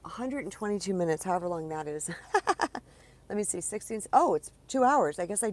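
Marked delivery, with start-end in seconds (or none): 2.1-2.8 high burst of laughter. Real, not acted. Surprised at length.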